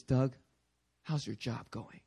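low, soft question; gentle condescending God. Acted. God asking Doug about work, gentle and low. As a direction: ask it quietly, leaning down to him. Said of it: "so he divinely condescends".

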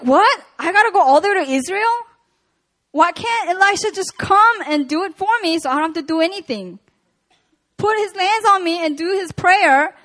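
hamming up Naaman's outraged whine; mock indignant Naaman. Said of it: "can you believe Naaman was so angry".

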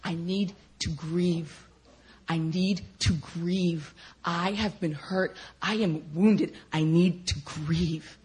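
slow, drawn-out plea to grieve; measured, aching admission of hurt; grieving plea. Retold or imitated. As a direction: plead it slowly, lingering on each word. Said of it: "my spirit inside of me was saying".

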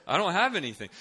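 high, loud protest of helplessness; panicked admission. Retold or imitated. Student caught without a gift, mock panic. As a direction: blurt the confession in mock panic.

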